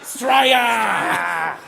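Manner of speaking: long drawn-out bogan cheer; mock patriotic shout. Presenter cheers after unveiling the Cloak of the Bogan, drawn-out shout. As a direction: holler it out to the crowd.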